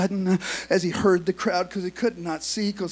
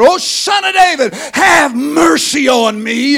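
loud, higher desperate plea, drawn out slowly; crying out for mercy; 7 semitones higher, 13 dB louder, slower than usual. Acted. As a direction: beg at the top of your lungs. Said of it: "he began to cry out".

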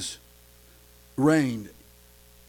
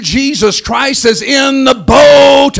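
preaching at full force; 3 semitones higher, 11 dB louder than usual. Acted. Preacher rallying the church that Jesus is in the boat. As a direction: ring it out to the congregation.

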